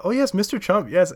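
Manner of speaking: high, loud mock Polish accent; bubbly Polish-accented agreement; excited Polish accent. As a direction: imitate an eager Polish tourist's accent. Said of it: "I'm pretty sure it was Polish accent".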